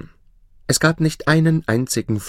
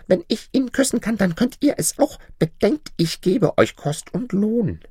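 hohe, gezierte Prinzessinnenstimme; Prinzessin; 12 semitones higher than usual. Acted. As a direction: spiel die verwöhnte Prinzessin mit hoher Stimme. Princess voice. (hochgestellte, affektierte Prinzessin)

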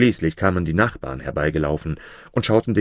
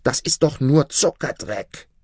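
shrieking contempt; 8 semitones higher than usual. A narrator's reading. Wife scorns the sugar cake, shrieking high. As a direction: spit it out in a shrill fury. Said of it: "schrie sie".

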